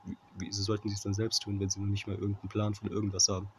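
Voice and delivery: ordinary delivery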